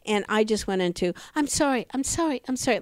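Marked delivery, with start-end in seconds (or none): 1.4-2.8 a hint of a small frightened girl, higher; like a little girl. Jogger apologizing to the yelling neighbor, lightly voiced little girl. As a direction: apologize timidly like a scolded child. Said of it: "like a little girl".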